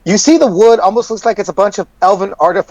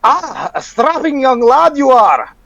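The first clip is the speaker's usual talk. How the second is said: merchant voice; 3 semitones higher than usual. Acted. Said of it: "two large hands grab your shoulders and goes".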